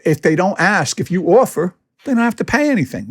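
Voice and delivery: usual speaking voice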